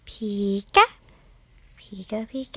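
loud cartoon creature chirp, leaping up at the end; Pikachu. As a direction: call it out like an excited little cartoon creature. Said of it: "I can do a good Pikachu".

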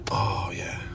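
breathy whispered sigh of satisfaction. Acted. Lover murmuring to partner afterwards, whisper. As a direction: drop to a hush. Intimate aside after climax.

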